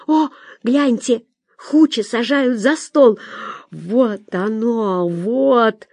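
slow, drawn-out marvelling; excited viewer. A narrator's reading. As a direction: savour each word in delight at the screen. Ольга excitedly watching the film. (восторженно, нараспев)

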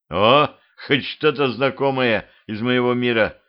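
usual speaking voice

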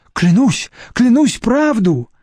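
high, pleading, trembling oath. A narrator's reading. Deputy director swears to Nadezhda in a trembling voice. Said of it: "дрожащим голосом повторил".

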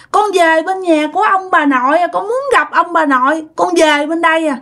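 put-on high, earnest small child; child's voice. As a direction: tell it simply like a young child. Spirit child recounts being pushed up.

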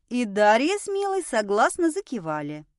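plain reading voice

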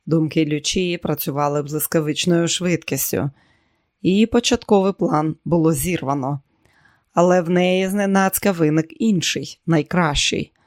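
ordinary delivery

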